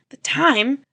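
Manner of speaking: usual speaking voice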